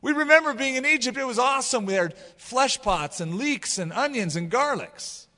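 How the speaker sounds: high-pitched mock complaint, easing down at the end; complaining. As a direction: grumble like a whiny crowd. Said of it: "they started complaining again".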